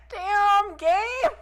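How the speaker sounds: high, loud delighted exclamation; excited outburst. Real, not acted. Gamer cheering a flirty dialogue option, high excited yell. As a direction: yell it out, thrilled.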